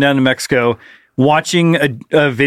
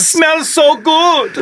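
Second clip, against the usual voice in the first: put-on very slow, high German accent; 12 semitones higher, much slower than usual. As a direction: drag it out in a creepy German accent. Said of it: "Anytime I've ever done a German accent".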